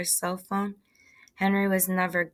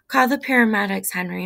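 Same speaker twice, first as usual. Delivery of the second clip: raised, urgent command, easing on the name; loud, higher-pitched order; yelled order; 3 semitones higher, 7 dB louder than usual. A narrator's reading. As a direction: yell for someone to phone for help. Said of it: "Ma yelled out".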